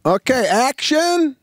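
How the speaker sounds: called high and drawn out; retold call of action. Director calling action on set, high and drawn out.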